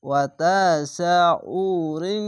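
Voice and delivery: "ওয়া তা সা উ রিং" with long vowels and hummed ending; nasal ghunna. Shown as a demonstration. Teacher joining the word with ikhfa ghunna, ending hummed. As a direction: draw out "তা" and "সা", hum the ng of "রিং". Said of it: "ইকফা গুন্না".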